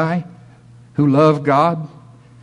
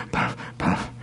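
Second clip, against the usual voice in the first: mock puffing; 9 dB quieter than usual. Imitating smoking.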